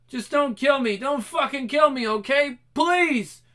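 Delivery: high, loud frantic begging; terrified pleading. Acted. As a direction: plead desperately for mercy. Captive begs for life.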